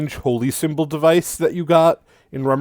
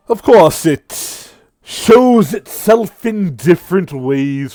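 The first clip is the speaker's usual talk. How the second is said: mocking devil; 4 dB louder, slower than usual. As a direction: sneer at him with relish. Sirik taunts.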